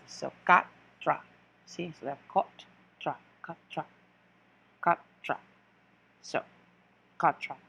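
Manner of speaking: quatre said katr, T and R run together at the end; French quatre. Shown as a demonstration. Teacher drilling four in French: quatre as katr. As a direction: quatre with a hard katr ending. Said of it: "a T R at the end".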